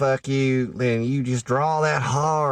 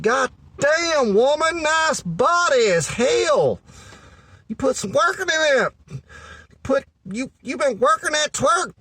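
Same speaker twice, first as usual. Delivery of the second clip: much higher, slow hammed-up leering praise; crude catcall; 10 semitones higher than usual. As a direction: ham up a crude guy hollering at a woman. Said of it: "walking up and just being like".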